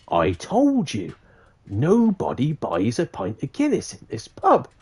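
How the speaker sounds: slow, drawn-out landlord's warning; ominous barman. Acted. Barman reminding customer of his warning, slow and ominous. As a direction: stretch each word like a knowing barman.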